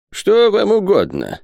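put-on high, slow old man's question; wary old miser. A narrator's reading. Isaac asking the captain what he wants, wary. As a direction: ask warily in a high, drawn-out voice. Isaac warily asks visitors.

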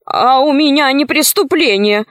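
quick protest. A narrator's reading. Wolf defends himself against the grandmother, hurried protest. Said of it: "сказал быстро волк".

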